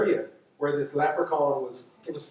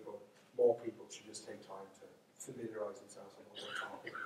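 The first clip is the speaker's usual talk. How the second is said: half-confiding whisper, almost to herself; whispered trailing remark; whispered aside; 5 semitones lower, 17 dB quieter than usual. Real, not acted. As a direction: drop to a breathy whisper for the last thought. Trailing aside, voice drops away.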